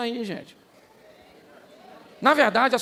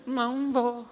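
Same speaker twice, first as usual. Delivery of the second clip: slow, softer, deliberate refusal; slow firm refusal; 3 semitones higher, 3 dB quieter, much slower than usual. Retold or imitated. Nehemiah refusing to come down to his enemies, slow firm refusal. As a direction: draw it out, calm and unmoved. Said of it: "Foi o que Neemias disse".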